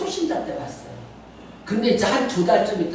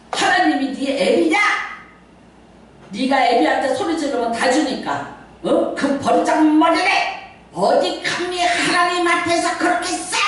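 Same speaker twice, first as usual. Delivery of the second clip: scolding father; 6 semitones higher than usual. Retold or imitated. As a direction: rebuke like a strict elder. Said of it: "저희 아버지가". (꾸짖는 엄한 아버지)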